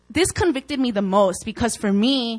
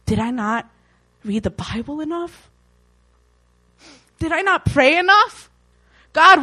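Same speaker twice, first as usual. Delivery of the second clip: a believer begging God for answers, building to an outcry; anguished pleading prayer; 7 semitones higher, 8 dB louder than usual. Retold or imitated. Believer crying out to God over unanswered prayer, desperate rising plea.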